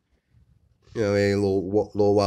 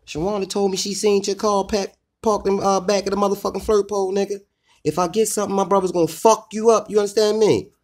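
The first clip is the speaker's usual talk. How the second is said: much higher, louder imitation of a cussing-out; mock baby mother cussing; 11 semitones higher, 4 dB louder than usual. Imitating baby mother cussing him out, high and loud. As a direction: shriek it like an angry girlfriend. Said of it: "Your baby mother cuss you out like".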